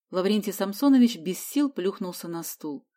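plain reading voice

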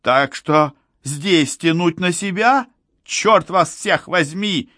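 high, slow, stretched-out cry; high-voiced, drawn-out yell; exasperated cry. A narrator's reading. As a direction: cry out in helpless frustration, pitched right up. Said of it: "крикнул Глушков".

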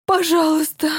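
breathless pleading. Acted. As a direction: beg over and over, out of breath. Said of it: "повторяла, задыхаясь".

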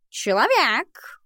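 slow, high drawling cat. Acted. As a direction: stretch every word like a lazy cat. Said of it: "ответил Альбус".

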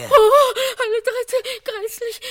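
shocked gasp. Acted. Cook shocked by third corpse.

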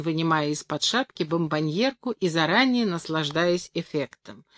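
ordinary delivery, nothing marked

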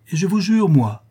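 high, loud, impassioned oath; solemn vow. A narrator's reading. Gentleman swears vengeance to the daughter, solemn vow. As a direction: swear it with full conviction. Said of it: "répondit Rodolphe avec solennité".

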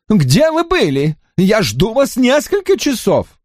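high, impatient complaining voice; fretful Drawlight. A narrator's reading. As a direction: whine at the late rider.

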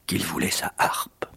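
ordinary delivery, nothing marked

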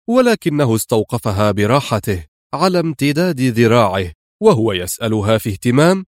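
normal talking voice

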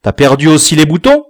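loud, fast, rising demand; quick, high-pitched grilling; accusing question. A narrator's reading. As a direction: fire an angry question at the child. Father interrogates son.

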